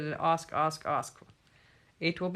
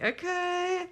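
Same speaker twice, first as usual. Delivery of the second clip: mock okay; 12 semitones higher than usual. Resigned self-quote.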